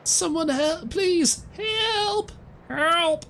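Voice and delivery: high, pleading cry for help from a frightened lover; loverboy scream. Acted. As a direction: shriek for help, hammed-up and desperate. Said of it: "Guy in love scream".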